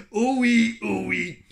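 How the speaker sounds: high, exclaimed stab at Québécois; attempted Quebec accent. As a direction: try it in a Quebec accent, loud first. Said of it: "je sais pas le faire en québécois". (tentative d'accent québécois)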